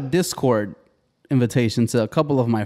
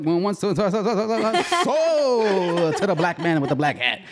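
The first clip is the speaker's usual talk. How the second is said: auctioneer; 6 semitones higher than usual. Retold or imitated. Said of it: "Sound like an auctioneer".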